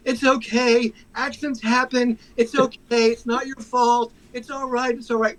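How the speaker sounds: sweet forgiving voice to the dog owner, pitched high; overly nice victim. Retold or imitated. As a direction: play the nicest victim ever. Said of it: "I was the nicest victim of a pit bull attack".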